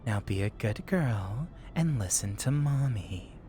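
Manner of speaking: low, slow, coaxing mommy voice. Shown as a demonstration. Streamer demonstrating for chat, low slow mommy voice. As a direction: drop low and draw it out like a commanding mommy. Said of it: "my mommy voice is like".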